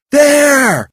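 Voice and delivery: high, loud warning shout; yell. A narrator's reading. Val warning Willow of the beast, urgent yell. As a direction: cry out the warning. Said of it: "Val yelled".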